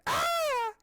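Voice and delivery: piercing high scream; shrill eerie shriek. A narrator's reading. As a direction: scream from the dark above. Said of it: "you hear an eerie scream".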